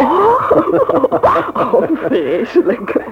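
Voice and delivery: loud laugh through the words, slow on vreselijk. Acted. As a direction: burst out laughing at the name. Laughs at the name Gerrit. (lachend, luid uitgesproken)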